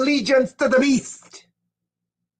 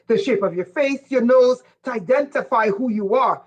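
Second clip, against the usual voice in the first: ordinary talk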